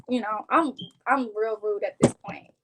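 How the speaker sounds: ordinary talk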